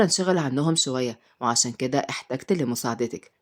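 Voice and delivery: low, subdued male character voice; deep-voiced, earnest man; earnest uncle. A narrator's reading. Uncle explains his need.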